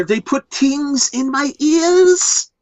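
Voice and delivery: high, slow, anguished movie-victim imitation; Wrath of Khan impression. Podcaster quoting Wrath of Khan's ear-worm victim, high mock-anguished wail. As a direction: wail it slowly in a high put-on accent. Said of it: "Star Trek Wrath of Khan".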